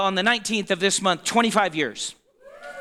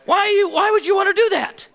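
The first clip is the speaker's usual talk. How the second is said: higher, louder, fast and incredulous; 9 semitones higher, 3 dB louder, faster than usual. Real, not acted. Preacher scolding the church for sinning again, incredulous. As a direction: ask it in disbelief.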